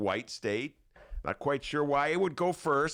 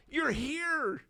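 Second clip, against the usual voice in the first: mock surprise.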